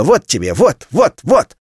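spiteful building. A narrator's reading. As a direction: tear it apart with every word.

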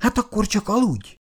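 a wife taking offence and turning away; offended. A narrator's reading. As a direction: turn away in a sulk. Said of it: "fordult el sértődötten".

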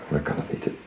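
bijna fluisterend, veel zachter. Acted. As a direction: laat het wegzakken tot een fluistering. Intiem gesprek, bekentenis. (zacht fluisterend)

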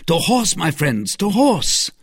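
high, ringing call to action; rallying cry. Acted. D'Artagnan to his friends, rallying cry. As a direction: rouse them to mount up.

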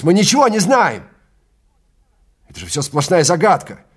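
high, loud frantic yell; shout. Acted. Travis yelling about the unknown, loud and high. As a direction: cry it out in alarm. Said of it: "крикнул Трэвис".

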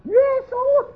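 seru nama itu dengan nada sangat tinggi; menirukan teriakan. Retold or imitated. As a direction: tiruan jerit istri yang histeris. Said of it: "teriak-teriak". (pekikan tinggi menirukan orang berdoa histeris)

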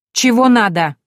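loud, high, curt retort; curt challenge. A narrator's reading. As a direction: bark it back at him. Heroine snaps back at him.

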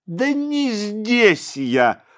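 put-on high, slow teasing child; eerie child voice. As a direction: sing it out dismissively. Boy answers.

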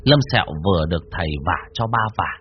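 usual speaking voice

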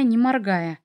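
ordinary delivery

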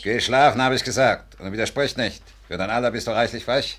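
loud, sharp order to go to bed; stern scolding. Acted. Man scolding the girl to go to bed, loud and stern. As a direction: scold the cheeky girl hard.

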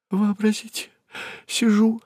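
high woman's voice, upset; shaken, agitated. Acted. Secretary telling the accountant what happened, agitated. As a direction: begin the account high-pitched and overwrought. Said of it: "рассказывала, трясясь от волнения".

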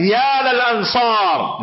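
long drawn-out rallying call. Retold or imitated. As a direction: imitate someone summoning his companions, slowly. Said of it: "dia panggil teman-temannya".

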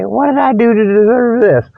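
ordinary talk, nothing marked

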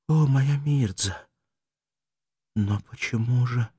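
slow, drifting dying man, long pauses; fading voice lost in a dream; weakening voice. Acted. Dying Arto speaks to Mirdza, weak and slow. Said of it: "произнёс слабеющим голосом".

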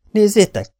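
high, loud call to look; excited call. A narrator's reading. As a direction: grab their attention with sudden excitement. Mark points at the screen excitedly.

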